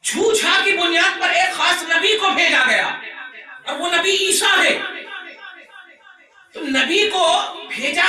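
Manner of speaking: loud, high-pitched preacher's oratory; fiery preaching. Acted. Preacher telling the crowd why a prophet was sent, fiery preaching.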